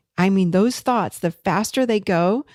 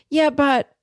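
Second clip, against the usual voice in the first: higher, a bit louder, whiny "Yeah, but"; mock adolescent; 7 semitones higher, 4 dB louder than usual. Coach mocking the adolescent mind, higher and louder. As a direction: play the inner teenager brushing it off. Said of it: "hello, adolescent chair".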